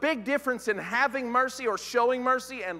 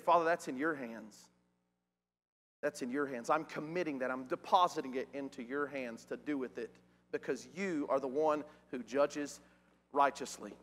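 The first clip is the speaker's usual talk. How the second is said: low, soft prayer of trust; quiet surrender; 7 semitones lower, 7 dB quieter than usual. Retold or imitated. Jesus entrusting judgment to his Father, low and quiet. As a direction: hand it over gently, voice kept down.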